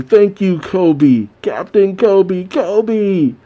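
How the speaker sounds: mock a crew hailing its hero; cheering crowd. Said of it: "with the other Marines cheering".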